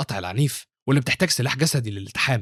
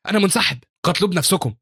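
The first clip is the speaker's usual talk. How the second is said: high-pitched frightened refusal; fearful; 6 semitones higher than usual. A narrator's reading. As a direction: bail out in a panic. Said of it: "وبيقول بخوف".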